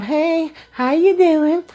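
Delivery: high, sing-song fake-friendly hello; fake sweet greeting. Karen greeting the office staff with a high fake-sweet hello. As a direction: sound sugary to hide trouble.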